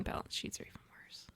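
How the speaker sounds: unvoiced whisper aside. Real, not acted. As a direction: breathe it as a joking whisper.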